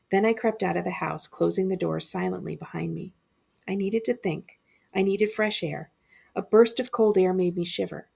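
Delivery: ordinary delivery, nothing marked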